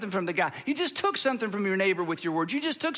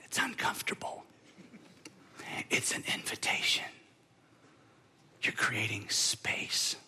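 whisper; 10 semitones lower, 7 dB quieter than usual. Real, not acted. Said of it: "sermon point".

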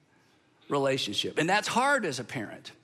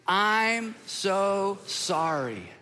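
slow group apology; much slower than usual. Shown as a demonstration. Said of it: "let's practice this out loud".

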